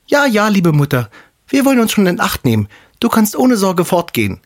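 put-on little goat kids. The kids reassure their mother, high eager kid voices. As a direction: chirp it back like small goat kids reassuring mum. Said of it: "Die Geißlein sagten".

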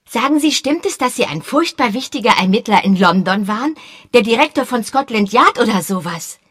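put-on high, loud, quick boyish voice; eager boy. A narrator's reading. Boy Peter pestering Sir Henry, high eager voice.

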